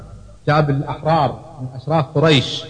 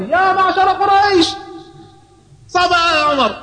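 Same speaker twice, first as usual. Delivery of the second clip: high, stretched public cry; calling out. A narrator's reading. Jamil announces Umar's conversion to all Quraysh, calling out. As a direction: call it out like a town crier. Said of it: "فورا قام وبدأ ينادي".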